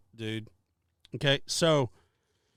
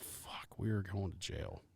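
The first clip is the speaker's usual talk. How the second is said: whispered dread; 6 semitones lower, 14 dB quieter, slower than usual. Retold or imitated. Retold dread as cop approaches.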